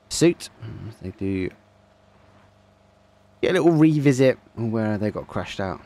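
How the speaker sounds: brisk esports commentary, lively and running; live play-by-play. Acted. Caster narrating the final game to viewers, lively play-by-play. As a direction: call the action for the stream.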